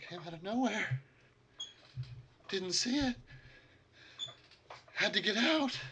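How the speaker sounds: high, strained, haunted voice; a broken man muttering in terror; frightened muttering. Acted. GM voicing a shell-shocked survivor, high and frightened.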